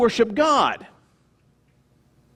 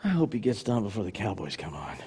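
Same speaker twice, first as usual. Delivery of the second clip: low, quiet aside; mock bored churchgoer, very low and soft; impatient listener's thought; 12 semitones lower, 9 dB quieter than usual. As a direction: grumble it privately under the sermon. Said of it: "some of you are listening going".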